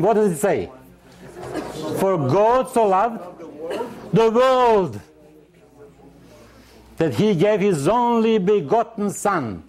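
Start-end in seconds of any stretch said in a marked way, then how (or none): 2.0-9.6 higher, slow, ringing recitation of the verse; preaching the verse with full weight; declaimed scripture. Acted. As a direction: lift the verse up like a proclamation.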